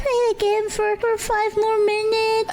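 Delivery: high, sweet begging voice; cutesy pleading. Retold or imitated. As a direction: plead like a cute little kid. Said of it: "she was like".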